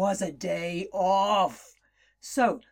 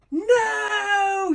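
high, loud cry of no; yell; 9 semitones higher, 4 dB louder than usual. Acted. Jemima shouting at the snake to stop, high and loud. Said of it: "yelled Jemima".

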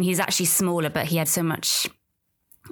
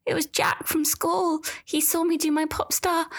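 put-on high little-girl voice, dropping to a whisper; embarrassed little girl; 12 semitones higher than usual. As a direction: play the mortified daughter, pitched very high. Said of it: "She was like".

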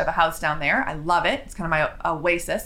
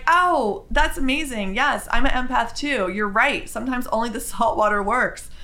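delighted reply; 3 semitones higher than usual. Retold or imitated. I just got this big smile.

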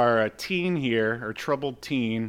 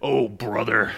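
Hulk Hogan; 5 dB louder than usual. Shown as a demonstration. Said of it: "That's much better".

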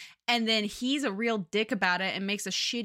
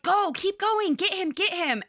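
high, louder acted urging; urgent urging; 9 semitones higher, 3 dB louder than usual. Injured heroine sending him after the thief, urgent high cry.

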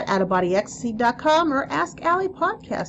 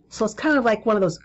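ordinary talk, nothing marked

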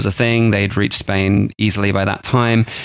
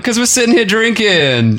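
much higher, hammed-up farmer voice; mock old farmer; 11 semitones higher, 3 dB louder than usual. Farmer answering his wife over wine, mock protesting voice. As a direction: defend yourself as a comic old farmer. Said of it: "old Catalonian farmers just sitting around".

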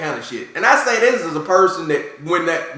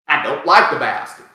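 ordinary talk, nothing marked

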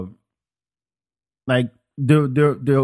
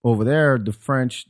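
normal talking voice

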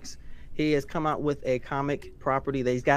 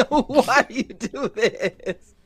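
high, slow, laughing whine; whiny laughing; 6 semitones higher, 6 dB louder, much slower than usual. Retold or imitated. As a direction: whimper it through laughter. Imitating friend burning on chips.

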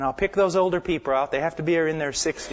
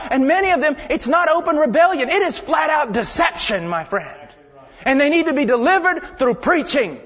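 raised, driving preacher's voice; full-force preaching; 9 semitones higher, 6 dB louder than usual. Acted. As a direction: preach it out to the whole church. Preaching to the congregation.

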